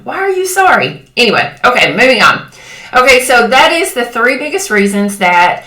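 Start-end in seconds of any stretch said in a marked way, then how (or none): 0.0-1.1 mildly exasperated, pitch jumping high; frustrated. Retold or imitated. Questioning a friend's apology over scissors, mock frustrated. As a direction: imitate your own baffled, high-pitched exasperation. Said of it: "I would get so frustrated with her".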